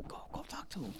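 soft whispered push; whispered urging. Retold or imitated. Girl urging her friend toward a boy, whispered. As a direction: hiss it to a friend. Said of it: "She's like".

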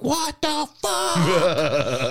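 drawn-out, high-pitched reenacted yell. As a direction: holler it like a stunned fan alone in the car. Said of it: "I'm yelling in my car".

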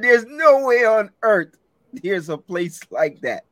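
high, loud, drawn-out incredulity; big storyteller's disbelief. Retold or imitated. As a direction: exclaim it slowly, refusing to believe. Animated retelling of disbelief.

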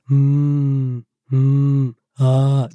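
low, drawn-out labour moan; cow noises. Retold or imitated. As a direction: imitate long low moos through labour. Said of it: "I'm just making cow noises".

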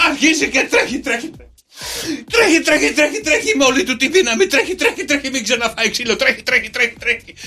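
breathless running narration. A narrator's reading. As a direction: tell it like a chase.

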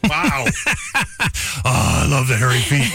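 breaking up with laughter; laughing. Real, not acted. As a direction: laugh through the words. Reacts to hairy feet text.

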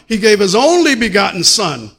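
loud preached line, stress on only; shouted preaching. Acted. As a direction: hammer it home to the church. Preaching climax vs calm repeat.

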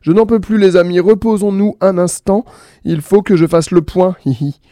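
tired Point. Acted. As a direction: sigh out the need to stop, then giggle. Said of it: "Point s'arrêta et dit".

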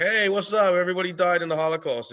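a hammed-up confident stand-up; mock cheerful comic opener. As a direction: chirp it like a comic sure he's killing. Mocking a comic bombing.

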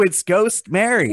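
normal talking voice

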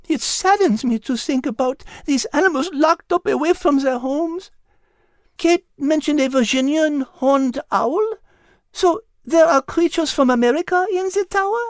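high, French-tinged voice, gently sad; a tender French lady cat grieving; French cat character. Acted. Liz the French cat speaks.

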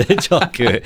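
high giggling laugh. Real, not acted. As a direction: laugh through the words. Laughing at the pronunciation joke.

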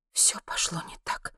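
hushed, voiceless inner voice; whispered thought. A narrator's reading. Monti thinks it all went wrong, whispered. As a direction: whisper it as if to yourself. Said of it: "подумала Монти".

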